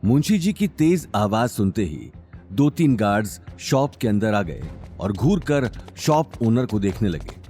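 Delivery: ordinary delivery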